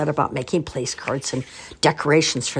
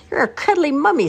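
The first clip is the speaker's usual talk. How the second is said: put-on child's voice; 13 semitones higher, 4 dB louder than usual. A narrator's reading. Little girl reassures mother.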